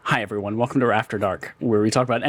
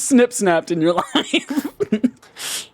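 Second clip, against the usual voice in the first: laughing; 10 semitones higher than usual. Real, not acted. Laughing while asking.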